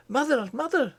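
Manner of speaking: put-on urgent boy calling his mother; Curdie crying out. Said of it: "he cried".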